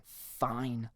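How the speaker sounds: soft, resigned sigh of a word; sighing resignation. A narrator's reading. Friend reluctantly agreeing to help, resigned. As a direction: give in quietly. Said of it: "let out the longest sigh".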